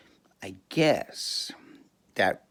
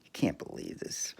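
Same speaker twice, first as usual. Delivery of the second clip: whisper; 8 dB quieter than usual. Real, not acted. Whispered aside.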